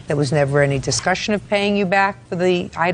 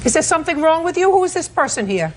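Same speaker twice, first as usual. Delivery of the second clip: incredulous scolding; 8 semitones higher than usual. Real, not acted. Judge rebukes defendant.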